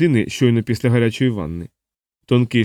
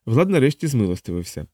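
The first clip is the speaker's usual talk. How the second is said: plain reading voice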